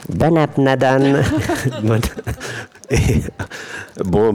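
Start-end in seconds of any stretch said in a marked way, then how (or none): 0.1-1.2 high squeaky helium voice. Real, not acted. 1.3-2.6 helium laugh. Real, not acted.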